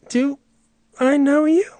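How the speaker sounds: very high, soft questioning voice; puzzled onlooker. Acted. Baffled listener to the man with a new accent, high timid question. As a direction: ask it high and small, rising. Acted reply to the put-on accent.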